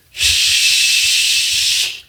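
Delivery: long hushing "shh" of a slide; sliding sound. Retold or imitated. Popcorn showing how he slid the glasses, hissed sliding noise. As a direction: imitate a smooth slide with a drawn-out shh. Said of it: "like this".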